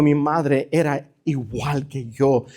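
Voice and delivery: low, soft, slow retold confession; retold tearful admission. Missionary admits to pastor about his mother, subdued retold tearfulness. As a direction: quote a weeping man, subdued and slow. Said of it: "empezó a llorar".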